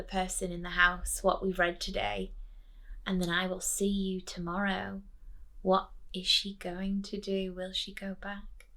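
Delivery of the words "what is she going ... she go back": ordinary delivery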